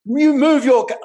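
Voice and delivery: loud, high, venomous yelling at a driver; retold furious cussing. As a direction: spit out the insults loud and high. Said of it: "This woman was venomous".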